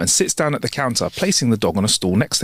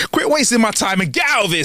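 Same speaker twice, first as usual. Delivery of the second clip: higher-pitched, fed-up order; irritated dismissal; 9 semitones higher than usual. Acted. Bartender kicks out man and talking dog, irritated. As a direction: order them out with mock annoyance.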